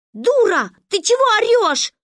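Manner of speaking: very high, loud angry yell; bristling anger. A narrator's reading. Girl snapping at her crying friend, angry. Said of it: "взъерепенилась Маня".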